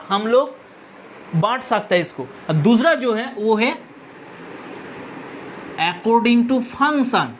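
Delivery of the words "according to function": very slow, each word drawn out; slow dictation. Shown as a demonstration. Teacher dictating the second type slowly to students. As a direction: lay out the term slowly for notes. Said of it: "function मतलब कार्य".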